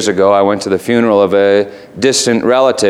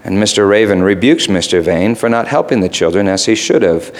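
ordinary talk

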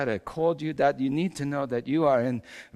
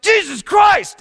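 high, loud startled cry of pain; pained yell; 11 dB louder, slower than usual. Retold or imitated. Carpenter yelling after his eye is gouged, loud cry. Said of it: "I remember I yelled".

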